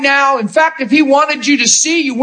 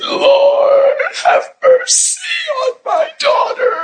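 crying plea; slower than usual. Acted. Mother clinging to Jesus' cloak, sobbing out a plea. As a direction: wail the plea through tears.

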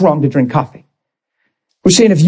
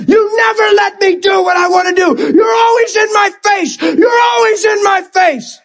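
high, wailing angry tirade; re-enacted screaming; 12 semitones higher than usual. Pastor replaying himself at nineteen raging at his Bible, screaming. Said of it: "Screaming at my Bible".

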